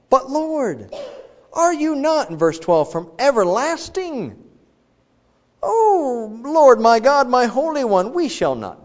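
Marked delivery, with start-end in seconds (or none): 0.1-2.3 much higher, louder plea; pleading prophet. Retold or imitated. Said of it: "the prophet responds". 2.9-8.8 much higher, louder plea; pleading prophet. Retold or imitated. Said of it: "the prophet responds".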